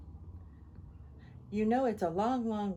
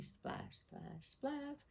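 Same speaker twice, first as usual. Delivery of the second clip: soft splash; 5 semitones higher, 11 dB quieter, much slower than usual. A narrator's reading. Sound effects in story.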